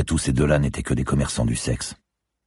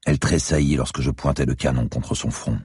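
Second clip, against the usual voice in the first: plain reading voice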